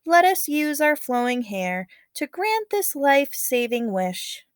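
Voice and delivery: lively read-aloud narration voice; story narration. A narrator's reading. Teacher reading a legend to students, expressive narration.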